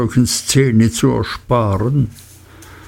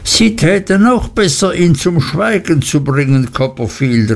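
higher, shaky warning call; trembling Uriah; 6 semitones higher than usual. Acted. Clerk warning the young friend, higher trembling cry. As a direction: point and warn, voice wavering up. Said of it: "rief Uriah und wies zitternd".

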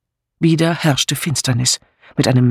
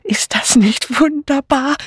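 high, slow, thrilled woman's gasp; gasping delight; 12 semitones higher than usual. A narrator's reading. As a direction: gasp it in high-pitched rapture. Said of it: "keuchte eine weibliche Stimme".

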